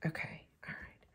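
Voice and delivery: whispered self-check. Real, not acted. Checking the glued pearls to herself, whispering. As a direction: murmur it under your breath. Muttering while gluing.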